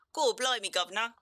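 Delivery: loud, high, hammed-up cockney; exaggerated fake cockney cry.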